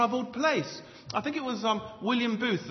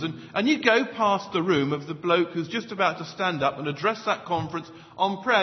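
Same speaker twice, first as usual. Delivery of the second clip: ordinary delivery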